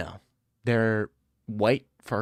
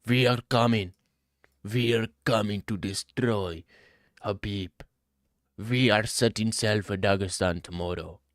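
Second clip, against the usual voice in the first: put-on Indian accent, deliberate and declaring; mock Indian accent; 2 semitones lower than usual. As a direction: pretend to be Indian. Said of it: "Pretend to be Indian and say".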